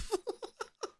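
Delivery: helpless squeaky giggle; high squealing laugh. Real, not acted. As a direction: crack up high. Laughing after retold scream.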